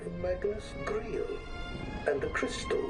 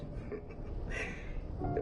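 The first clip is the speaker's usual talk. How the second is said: evil chuckle; 5 dB quieter than usual. Acted. Villain gloats over the prize.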